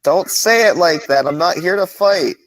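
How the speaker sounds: high, loud protest; shouting back. Acted. Traveler answers orcs in the cave, shouting back. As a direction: call it out so they hear inside.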